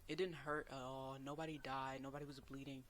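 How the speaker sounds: normal talking voice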